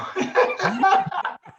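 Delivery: high, hearty peals of laughter. Real, not acted. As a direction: crack up at your own story. Laughs at being wanted for more.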